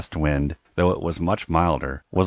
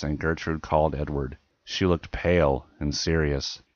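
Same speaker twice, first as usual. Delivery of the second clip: plain reading voice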